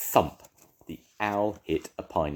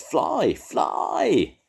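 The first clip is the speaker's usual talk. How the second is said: high, loud cry of fly; urgent shout; 12 semitones higher, 5 dB louder than usual. A narrator's reading. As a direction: urge the falling bird to fly. Percy urges falling bird.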